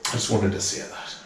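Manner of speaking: choked up, quiet. Real, not acted. Veteran tells the host about discarded soldiers, choked up and quiet. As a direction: voice sinking almost away with real emotion. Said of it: "it's emotional".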